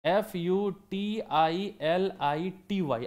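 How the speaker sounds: slow letter-by-letter spelling, one letter at a time. Shown as a demonstration. Teacher spells the word out for students, slow and letter by letter. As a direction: spell each letter slowly and clearly for the students. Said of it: "तो शब्द को पढ़ने का तरीका भी है भाई".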